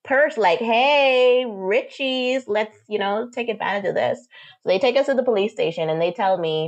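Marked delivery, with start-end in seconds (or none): 0.6-4.2 lightly voiced, higher-pitched opportunistic cops; a hint of gleeful scheming, put on; mock scheming officers. Officers eyeing a purse full of cash, mock scheming.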